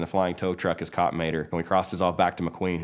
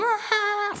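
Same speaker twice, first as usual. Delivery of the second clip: high shriek thrown in the air; terrified scream. Acted. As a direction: scream as you're flung upward. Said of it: "Mater's all".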